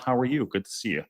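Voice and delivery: ordinary talk, nothing marked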